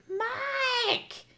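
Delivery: high yelling grandmother calling Mike; yelled name. Retold or imitated. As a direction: call the name high across the yard. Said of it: "yells across the fucking front yard".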